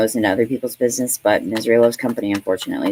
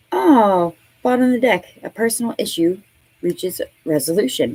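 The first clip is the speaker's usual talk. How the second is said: surprised; 10 semitones higher than usual. Real, not acted. Surprised at the card drawn.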